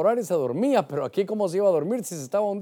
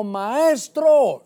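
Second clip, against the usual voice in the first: raised, louder mock call; 6 semitones higher, 5 dB louder than usual. Preacher voicing what Peter did not say, calling out. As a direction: call it out high, like hailing a teacher. Rejected address, contrasted with Señor.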